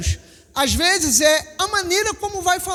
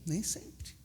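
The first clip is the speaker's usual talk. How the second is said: dropped to a breathy near-whisper; whispered nem sempre; hushed answer; 10 semitones lower, 20 dB quieter than usual. Real, not acted. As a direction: let the answer fall away almost unvoiced. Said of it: "answering his own question".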